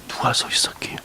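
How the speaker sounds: hushed suspense whisper; preacher teases the congregation in a whisper. Acted. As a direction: breathe the question to draw them in.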